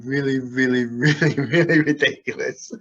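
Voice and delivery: amused, words bubbling with laughter; laughing. Real, not acted. Hypnotist laughing at silly voices.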